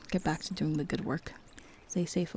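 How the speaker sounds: ordinary talk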